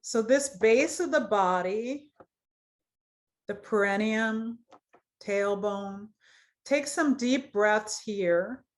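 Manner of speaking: measured phrases with long pauses; guided meditation. Acted. Yoga teacher leads students through root chakra, guided meditation voice. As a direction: guide awareness through the body, leaving space to feel.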